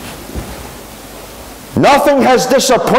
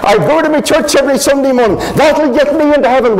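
higher, fast, indignant voice of a churchgoer; a pious listener objecting, pitch up and hurried; protesting churchgoer; 6 semitones higher, faster than usual. Retold or imitated. Churchgoer protesting to the preacher, high and hurried.

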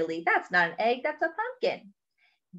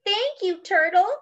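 high-pitched grateful exclamation; crying out; 11 semitones higher, 5 dB louder than usual. Acted. Duck and Hippo thanking Turtle, crying out. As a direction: sing out a storybook thank-you. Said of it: "cried Duck and Hippo".

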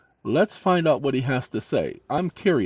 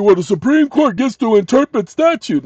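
much higher, louder mock voice of the know-it-alls; mocking everybody else; 10 semitones higher, 6 dB louder than usual. Host mimicking what everyone else says about the courts. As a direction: parrot the common claim in a silly high voice. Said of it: "everybody else will tell you".

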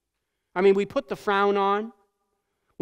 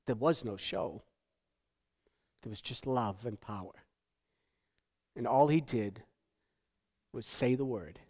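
lower, softer earnest preaching; solemn sermon; 7 semitones lower, 7 dB quieter than usual. Acted. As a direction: bring it down low and sincere after the mockery.

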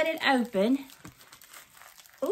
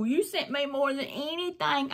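ordinary delivery

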